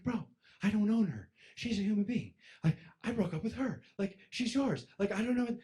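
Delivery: high, pleading voice. Retold or imitated. High pleading to scared friend about the girl. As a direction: beg him high and earnest. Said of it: "I'm pleading with him for months".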